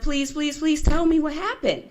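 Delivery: high-pitched, re-enacted begging; plead, repeating please with rising urgency. Niece begging aunt to tell what happened, desperate pleading. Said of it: "I'm begging my aunt".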